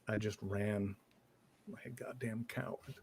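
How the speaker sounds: mild shame, voice dropping away softly; ashamed confession. Acted. Survivor admits fleeing the burning barn, quietly ashamed. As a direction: trail off into a near whisper, as if ashamed.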